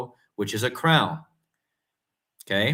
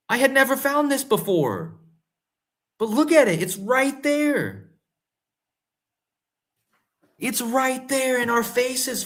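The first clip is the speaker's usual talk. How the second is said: excited; 8 semitones higher than usual. Real, not acted. Amazed at his own discovery.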